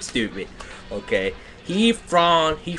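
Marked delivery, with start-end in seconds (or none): 0.0-1.3 "stupid." dropped low and softer, giving up; deflated mutter. Real, not acted. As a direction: let "stupid." sink low and quiet.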